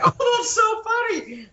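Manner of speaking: high, drawn-out mock delight; squealed impression of an amused friend; amused co-star. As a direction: imitate someone tickled, slow and way up high. Imitating co-star laughing at her.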